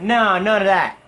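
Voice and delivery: mock emphatic objection; loud refusal. Imitated customer refuses the ingredients.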